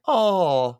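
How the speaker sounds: a fond, touched coo. Real, not acted. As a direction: melt at the sweet moment. Touched by the sibling scene.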